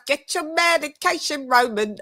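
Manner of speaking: high-pitched mock British accent. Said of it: "Shouts out to [name] for the British accent".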